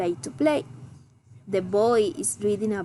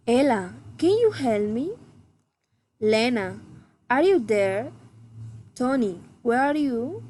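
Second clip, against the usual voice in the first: questions to a named person, climbing to the end of "can you help me?"; rising intonation. Shown as a demonstration. Teacher models direct address, rising intonation. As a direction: call the name, then lift "where are you?" at the end. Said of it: "rising intonation".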